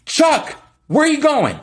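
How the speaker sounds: high, loud call across the house; yelling boss. Retold or imitated. Mover boss yelling at his worker, high and loud. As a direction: shout after a worker walking off. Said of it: "literally starts yelling at the other guy".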